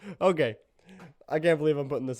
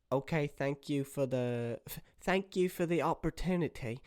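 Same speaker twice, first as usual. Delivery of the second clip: polite lady bear; 3 semitones higher, 4 dB quieter than usual. Acted. Bear answers the rejection in character.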